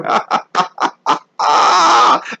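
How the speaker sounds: long cackling laugh at own blunder; laughter. Real, not acted. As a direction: burst out laughing after the punchline.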